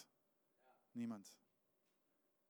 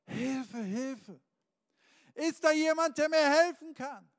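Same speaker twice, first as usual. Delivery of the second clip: high, loud shout for help; desperate cry for help; 11 semitones higher, 12 dB louder, slower than usual. Acted. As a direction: yell up the cliff with all your strength. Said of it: "und fängt an zu schreien". (verzweifelter, lauter Hilfeschrei)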